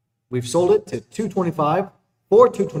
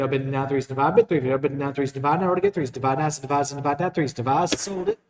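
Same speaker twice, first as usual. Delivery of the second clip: rapid rolling bid chant; auctioneer; 3 dB louder, much faster than usual. Acted. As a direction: rattle off the bids like an auctioneer.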